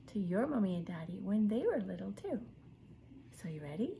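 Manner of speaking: ordinary delivery, nothing marked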